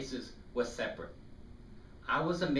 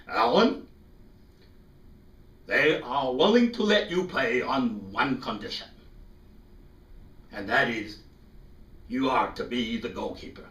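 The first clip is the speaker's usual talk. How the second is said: priest voice; 7 dB louder than usual. Retold or imitated. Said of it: "and says".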